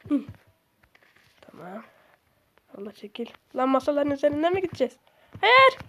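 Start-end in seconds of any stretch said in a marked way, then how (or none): 5.4-5.8 sharp high shout of no; real alarmed shout; panicked no. Real, not acted. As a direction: yell it as you fall. Panicked refusal mid-game.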